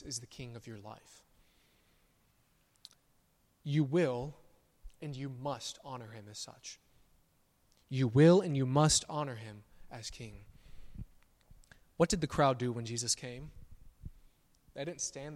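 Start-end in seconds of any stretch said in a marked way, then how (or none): none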